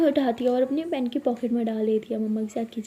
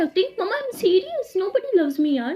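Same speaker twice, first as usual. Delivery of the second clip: squeaky protest; 8 semitones higher, slower than usual. Acted. Abira protesting mom.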